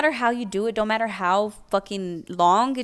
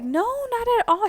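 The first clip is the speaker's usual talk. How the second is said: high, bright eager reply; eager yes. Retold or imitated. Accepting the leggings from the lady, high eager reply. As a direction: jump at the offer, up in the voice.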